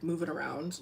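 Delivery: ordinary talk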